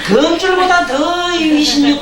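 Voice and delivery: laughing. Real, not acted. Monk amused at his own joke to the hall, laughing. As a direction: chuckle it out slowly, amused. Joke about Koreans fearing ropes.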